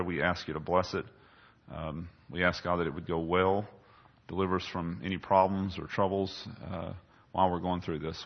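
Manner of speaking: quiet prayer. Real, not acted. Pastor opening in prayer, hushed and reverent. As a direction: pray reverently and softly to the Lord.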